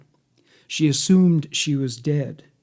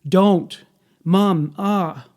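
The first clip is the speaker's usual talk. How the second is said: higher, louder cry for mum; mild mock scream; 4 semitones higher, 4 dB louder, much slower than usual. A narrator's reading. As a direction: read a child's scream with restraint. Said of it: "Leslie Ann screams".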